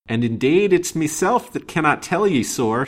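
high, loud broad Irish brogue; broad Irish accent. A narrator's reading. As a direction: lilt it like an Irish cottager. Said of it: "with a very broad Hibernian accent".

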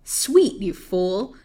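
scornful cry. Acted. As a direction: throw it back like a furious hag. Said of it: "cried the old hag".

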